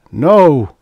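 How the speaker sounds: loud, high, forceful refusal; excited outcry. A narrator's reading. He protests her gloomy forecast, excited shout. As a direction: shout it out in alarm. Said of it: "In his excitement".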